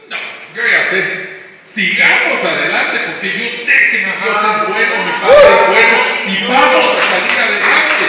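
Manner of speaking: loud, firm statement of faith; bold declaration. Pretend. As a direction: proclaim it with full conviction. (declaración fuerte y decidida)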